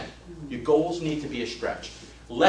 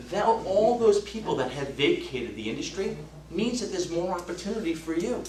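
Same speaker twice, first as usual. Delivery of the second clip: ordinary talk, nothing marked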